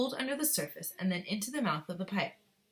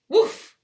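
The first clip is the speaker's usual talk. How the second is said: loud whoosh sound; 14 semitones higher, 16 dB louder than usual. Acted. Sound of boy shooting out.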